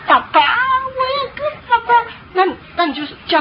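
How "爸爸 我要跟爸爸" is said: put-on whining child. Child nagging parent who is talking with guests, high whining. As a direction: whine like a small child demanding daddy. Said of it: "那他就一直".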